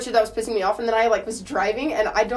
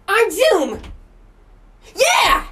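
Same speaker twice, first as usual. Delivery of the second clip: hyped cheer, much higher and louder; excited echo; 9 semitones higher, 6 dB louder, much slower than usual. Pretend. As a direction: shout "on Zoom!" like a crowd at a rave. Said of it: "That echo".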